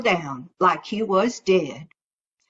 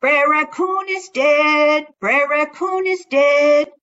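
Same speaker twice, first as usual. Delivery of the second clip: calling out; 5 semitones higher, 3 dB louder than usual. Acted. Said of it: "Brer Rabbit called out".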